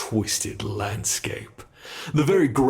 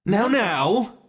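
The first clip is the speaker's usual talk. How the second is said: high, loud sing-song scolding; tut-tut the squirming captive from way up high; mock scolding; 10 semitones higher, 5 dB louder than usual. Monster chiding its struggling captive, high and loud.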